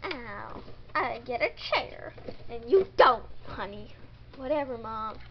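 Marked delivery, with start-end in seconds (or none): none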